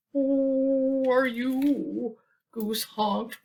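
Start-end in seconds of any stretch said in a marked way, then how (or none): 0.1-2.2 very slow, drawn-out, high and wary; scared question. Acted. Goose asking the ghost nervously, drawn out slowly. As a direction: stretch each word in fear.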